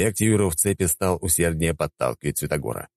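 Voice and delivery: plain reading voice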